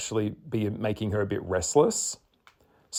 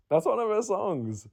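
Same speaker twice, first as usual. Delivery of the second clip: much higher, softer delighted repeat; excited realisation; 11 semitones higher, 4 dB quieter than usual. Real, not acted. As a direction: say it like it just clicked. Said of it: "I was just thinking that's one of her songs".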